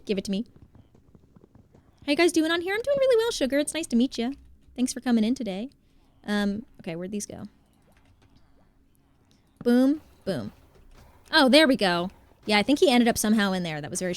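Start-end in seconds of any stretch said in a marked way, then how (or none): none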